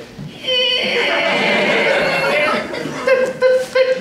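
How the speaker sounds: very high, strained squeal; straining child squeal. Acted. Comic imitating the girl on the toilet, straining squeal. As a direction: squeal like a little kid pushing hard.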